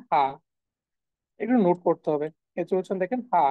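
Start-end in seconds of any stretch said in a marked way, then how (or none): 0.1-0.2 "হা" as breath h plus "ah"; letter name "হা", not English "aitch"; German letter H. Shown as a demonstration. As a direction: say "হা" as an open "hah". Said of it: "এইচ এর উচ্চারণটা হয়ে গেছে".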